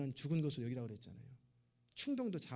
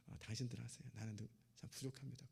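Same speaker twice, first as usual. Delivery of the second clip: much softer, self-effacing murmur; humble demurral; 3 semitones lower, 10 dB quieter than usual. Retold or imitated. Humble member declining to lead, soft self-effacing murmur. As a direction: shrink back and hand them the lead, softly.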